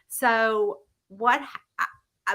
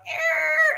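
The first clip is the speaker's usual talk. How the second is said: imitated tire screech. They're, like, going.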